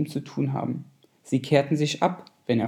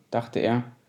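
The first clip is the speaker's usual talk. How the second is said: plain reading voice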